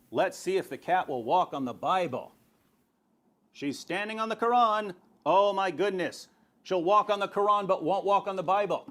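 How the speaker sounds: normal talking voice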